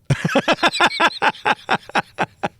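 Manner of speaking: long high staccato laugh; amused laugh. Real, not acted. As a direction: laugh on and on at your own jibe. Laughs at own joke.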